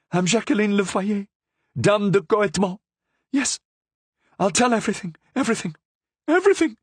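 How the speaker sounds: high, tumbling, frantic confession. A narrator's reading. Prisoner confessing to the Cardinal, frantic and high. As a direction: pour it all out, rising.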